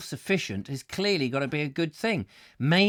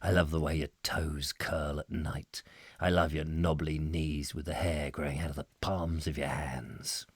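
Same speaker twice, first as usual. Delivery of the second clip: whispered lover's sweet talk; 14 semitones lower, 9 dB quieter than usual. Retold or imitated. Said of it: "That sort of thing".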